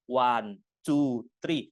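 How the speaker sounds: short clipped count, each number one beat; counting in English. Shown as a demonstration. Teacher recounting in English to show one syllable each. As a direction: tap out one, two, three. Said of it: "satu suku kata semua loh".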